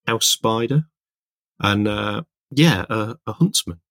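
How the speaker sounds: ordinary delivery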